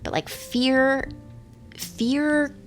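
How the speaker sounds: "fear" stretched slowly, pitched high; drawn-out "fear". Real, not acted. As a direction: linger on "fear", high and slow. Dwelling on the word fear.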